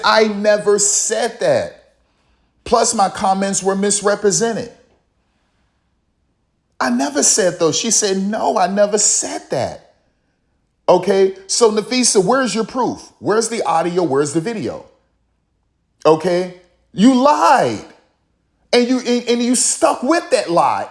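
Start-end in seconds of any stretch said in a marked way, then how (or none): 0.0-9.9 higher-pitched imitation of a flustered denial; mock protesting denial. Commentator imitating Kathy's high, insistent denial. 16.0-20.9 accusing outburst. Real, not acted. Accusing Nafisa directly.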